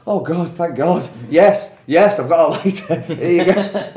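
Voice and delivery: high, loud acted relief; hammed-up relieved outburst breaking into a laugh; huge relief. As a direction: gush with relief, over-eager to please. Acting out relief at finally understanding.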